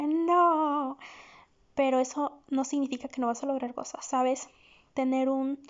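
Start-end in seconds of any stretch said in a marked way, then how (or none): 0.0-1.0 retold protest. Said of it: "Y así como que".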